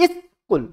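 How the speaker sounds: স্কুল as "is", a gap, then "kul"; syllable demonstration. Shown as a demonstration. Teacher confirming two syllables for students, স্কুল split as "is... kul". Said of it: "দুটো syllable পেলাম".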